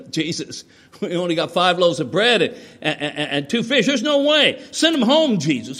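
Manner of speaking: acted-out protest, pitch jumping on the refusal; incredulous protest. Apostles object to feeding the crowd, incredulous protest. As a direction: voice climbing high and loud in objection.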